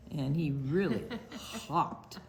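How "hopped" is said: usual speaking voice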